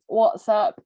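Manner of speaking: ordinary talk, nothing marked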